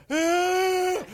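high, loud mock cry; hammed-up kid crying out; imitated child's wail. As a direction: wail like a fallen toddler.

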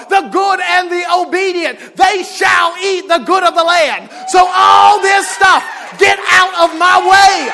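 shouting it out, high and loud; loud preaching. Real, not acted. The preacher telling the church why he's loud, shouting. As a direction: preach at full force. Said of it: "I've got to be loud about it".

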